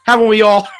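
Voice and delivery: laughs through the question; chuckling, amused at the joke; laughing it off. Real, not acted.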